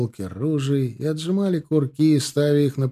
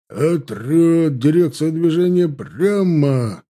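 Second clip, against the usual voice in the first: loud, high, drawn-out order; shouted command; 5 semitones higher, 6 dB louder, much slower than usual. A narrator's reading. Salov's order reaching the troop from afar, shouted command. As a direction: stretch it so it carries afar. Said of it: "долетела команда Салова".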